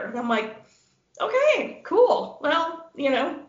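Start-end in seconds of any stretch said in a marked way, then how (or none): 1.2-3.4 mock-cheery send-off. Woman retells her date leaving for beer, mock cheery. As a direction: chirp the okay, then draw out the goodbye.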